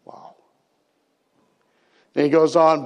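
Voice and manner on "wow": breathed "Wow" with no voice; awed whisper. Real, not acted. Preacher marvelling at Jesus' words to the congregation, whispered. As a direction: let the wonder come out as a whisper.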